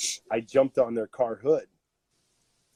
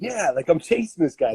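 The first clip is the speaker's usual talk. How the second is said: self quoted; 7 semitones higher, 3 dB louder than usual. Retold or imitated. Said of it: "I'm like".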